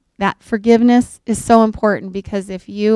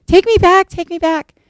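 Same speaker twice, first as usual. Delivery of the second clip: much higher, desperate pleading that fades; hysterical pleading; 10 semitones higher, 4 dB louder than usual. Retold or imitated. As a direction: beg to be taken back, panicking, then dying down. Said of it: "and is hysterical".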